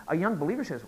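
normal talking voice

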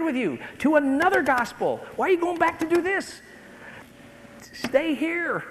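high, loud, impassioned preaching; worked up. Real, not acted. Preacher begging believers not to go back, worked up. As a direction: get carried away pleading, pitch climbing. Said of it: "Am I getting worked up a little bit?".

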